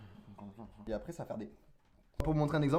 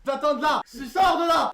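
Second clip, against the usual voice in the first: loud, high yell to get out; shouting; 10 semitones higher, 7 dB louder than usual. Real, not acted. Crie pour qu'il sorte. (crier fort)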